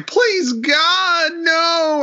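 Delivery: hammed-up begging as he wakes from a nightmare; mock nightmare plea.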